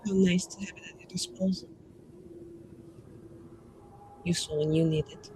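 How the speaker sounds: soft, understated reply in character; quiet dry retort. Acted. Mage answering talk of arcane power, quietly.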